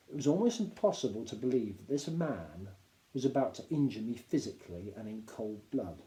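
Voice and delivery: usual speaking voice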